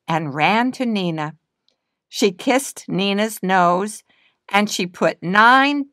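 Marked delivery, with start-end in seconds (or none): none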